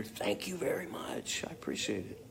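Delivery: soft, breathless whisper; whispering thanks to Jeanette. Real, not acted. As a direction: whisper it close and grateful. Thanking a fan in the chat.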